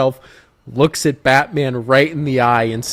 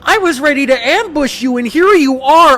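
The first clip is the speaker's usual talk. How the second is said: high, loud squeal of disbelief; mock outrage; 13 semitones higher, 5 dB louder than usual. Salesman to chained-up captive he meant to ambush, mock outrage. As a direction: climb into a high, theatrical rant.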